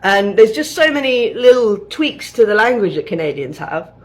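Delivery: ordinary talk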